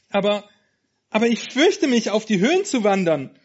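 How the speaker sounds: high, trembling stammer of a scared lamb; scared lamb telling her friend she's afraid; frightened. Acted. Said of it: "rief Wertvoll ängstlich".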